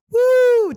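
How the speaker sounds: a high, loud whoop of hype. Real, not acted. Host hyping Caveman Coffee to listeners, loud whoop. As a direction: whoop it up for the sponsor.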